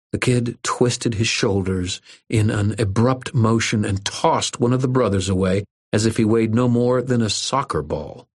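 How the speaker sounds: usual speaking voice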